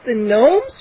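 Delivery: plain reading voice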